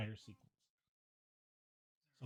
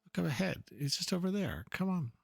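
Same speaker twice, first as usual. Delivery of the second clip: higher, puzzled seeker; searching hero voice; 4 semitones higher, slower than usual. Acted. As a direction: look around for him, curious and a bit worried. Voices searching game character.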